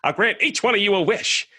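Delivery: high, excited genie; hammed-up grateful genie. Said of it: "and he says".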